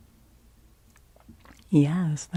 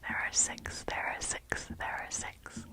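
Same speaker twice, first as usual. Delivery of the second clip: hushed ASMR whisper, fading softer; 10 dB quieter than usual. Acted. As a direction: whisper it repeatedly, each time softer. ASMR answer repeated in whisper.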